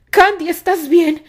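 anxious check on her; worried. A narrator's reading.

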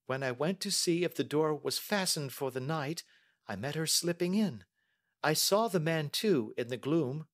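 put-on high-pitched young lady, earnest; Mary. Young lady telling the detective what she heard, earnest. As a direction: answer carefully in a high voice.

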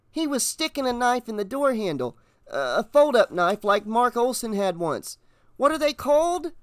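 high-voiced boy explaining; young boy's lifted, eager voice; earnest boy witness. A narrator's reading. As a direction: blurt out what you saw, searching for the word.

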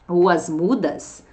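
usual speaking voice

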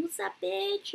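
squealed high greeting to a bestie; high excited greeting. Retold or imitated. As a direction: pitch it way up, playful. Quoting a close friend greeting.

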